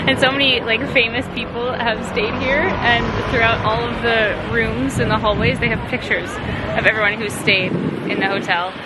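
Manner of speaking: usual speaking voice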